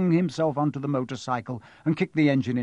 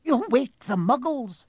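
McGonagall voice; 11 semitones higher than usual. Acted. McGonagall scolds.

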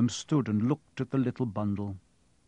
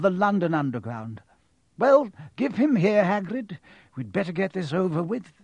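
Dumbledore voice; 6 semitones higher than usual. Acted.

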